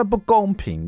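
put-on high-pitched sulky kid protest; mock child whine. Father mimicking his son's complaint, high mock whine. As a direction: whine it like a sulking son. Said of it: "我说，".

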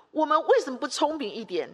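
usual speaking voice

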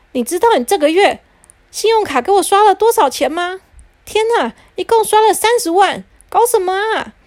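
angry father. Acted. Father yelling at his wife about the card bill. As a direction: explode over the credit card bill.

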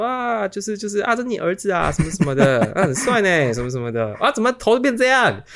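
high, chirpy gossiping exclaims; imitating nurse coworkers. Coworkers fussing over the son's head, high chatty exclaims. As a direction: mimic nosy coworkers fussing over him. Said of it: "那就会说".

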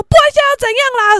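fast, high, loud challenge; mock quarrelsome yell; imitated belligerent mother. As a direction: fire back as if itching to argue. Other mother keeps quarrelling.

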